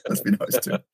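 high breathy laughing repeat; laughing echo. Real, not acted. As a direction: laugh the words out high and airy. Laughing echo of co-host's tease.

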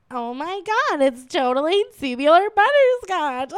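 put-on Valley Girl gush through a laugh; Valley Girl accent. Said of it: "she'd go".

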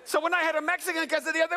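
normal talking voice

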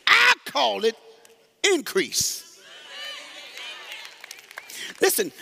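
high, loud, shouted sermon climax; chanting preacher, near singing; preaching at full force. Acted. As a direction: whoop it up like a revival preacher.

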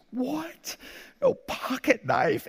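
high, drawn-out mock child's dismay; dismayed little boy. Son's dismay at refusal, retold.

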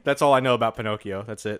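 usual speaking voice

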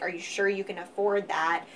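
ordinary delivery

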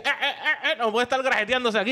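high playful scolding through laughter; laughing mock patrol. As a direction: bust them jokingly, laughing. Imita su patrulla, riendo. (regaño de broma entre risas)

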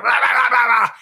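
mock yelling coach. Mocking a yelling coach to the host, hammed up. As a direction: parody a coach barking nonsense. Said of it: "the coach is just like".

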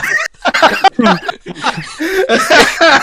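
real high laughing fit; burst of high laughter. Real, not acted. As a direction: break into helpless laughter. Reacting to the botched reveal.

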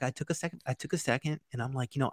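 usual speaking voice

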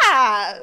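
loud imitated cry; Topanga's squawk. Co-host mimicking Topanga's voice, loud mock cry. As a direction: echo it as a mock yelp. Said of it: "Like, literally her voice is".

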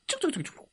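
high, soft rapid chirps; scurrying escape noise. A narrator's reading. Beaten alien runs away, quick high squeaky noise. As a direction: imitate tiny fleeing footsteps.

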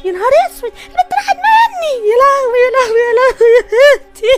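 very high, sobbing cries; wailing in grief. Acted. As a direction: scream and weep for help over a lost daughter. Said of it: "بتصوتي ليه". (عياط وصويت أم ملهوفة)

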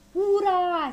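high, loud hurrah; cheering shout. A narrator's reading. Small folk cheer the balloon taking off, cheering shout. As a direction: cheer as if for a whole crowd. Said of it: "strigară piticii".

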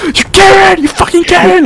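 very high imitated outrage; hammed-up outraged cry; mock angry yell. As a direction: act out hurling an insult, high-pitched. Re-enacts the shouted insult.

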